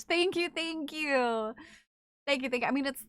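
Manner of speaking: ordinary delivery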